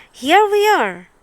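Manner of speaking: a bird proudly announcing home; cheerful bird. Acted.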